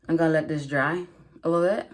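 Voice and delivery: usual speaking voice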